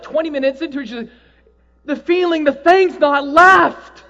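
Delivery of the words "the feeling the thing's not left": higher, louder, slow wonder; amazed report. Retold or imitated. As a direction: marvel that it stays.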